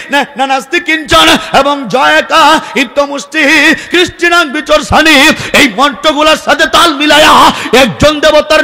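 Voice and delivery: a preacher rousing the congregation; preaching at full force. Acted. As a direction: preach it hard to the crowd.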